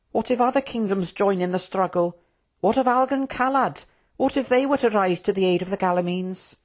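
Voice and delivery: high, anxious royal voice; worried prince. Acted. Prince questioning the war plans, high and worried. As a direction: press them with high-pitched concern.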